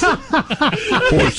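high squealed rock yelp, imitating; lightly voiced rock-star shriek; [name] yelp. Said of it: "He did that [name] thing".

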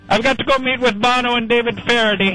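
broad comic Irish brogue; put-on Irish accent. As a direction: play a jokey stage Irishman with a brogue.

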